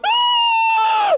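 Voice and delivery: high shrieking scream of fright; terrified scream. Retold or imitated. Disciples seeing Jesus appear, scream in fright. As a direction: shriek like you've seen a ghost. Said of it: "And they're going".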